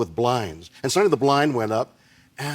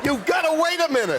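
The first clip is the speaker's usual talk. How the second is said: high shout. Real, not acted. Guest shouting high to the host that he must wait. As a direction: insist at the top of the voice.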